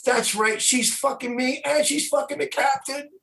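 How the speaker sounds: high-pitched mock scream at ordinary volume; retold scream. Singer ranting over the ship's PA, high mock scream. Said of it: "she started screaming".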